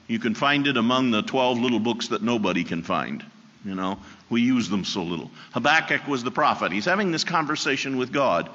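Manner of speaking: usual speaking voice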